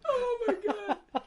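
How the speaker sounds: slow, high, laughing exclamation; laughing disbelief. Real, not acted. Reacting to the pad joke with slow laughing disbelief. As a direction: drag it out while cracking up.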